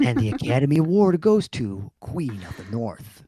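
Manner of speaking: grand, put-on awards-show announcement; mock awards announcer. Husband crowning his wife's performance, mock awards announcer. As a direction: announce it like an Oscar presenter.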